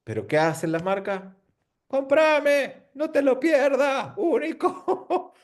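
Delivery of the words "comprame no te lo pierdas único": high, hammed-up hard-sell ad voice; mock pushy ad shout. Speaker mocking how brands yell at customers: hammed-up hard-sell shout. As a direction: shout it like a cheesy sales pitch, laughing.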